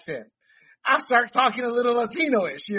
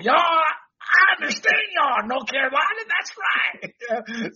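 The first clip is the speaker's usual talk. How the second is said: mock Southern drawl; 6 semitones higher, slower than usual. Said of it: "She was just trying to play it off".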